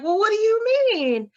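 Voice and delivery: high, loud surprised question. Retold or imitated. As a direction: ask it high and startled. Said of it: "And she was like".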